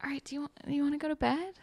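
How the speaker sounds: quick, high, soft offer; imitated small high voice, rushed; retold awkward cover-up. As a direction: hurry lightly past the awkwardness. Covering awkwardness after unreturned confession.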